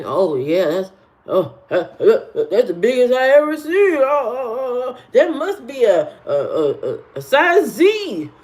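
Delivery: hammed-up, stammering awe with swooping pitch; mocking ogling men. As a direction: imitate a dumbstruck gawker, stuttering on the ohs. Mocks men guessing bra sizes.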